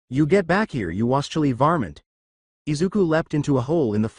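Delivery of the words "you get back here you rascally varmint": lisping mock yell, wascally; mock hunter yell with lisp; cartoon hunter yell. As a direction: chase him like a cartoon hunter. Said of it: "talking like this".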